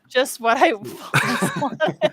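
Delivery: slow sarcastic laugh. Acted. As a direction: drawl it with sarcasm. Sarcastic reply to tattoo prompt.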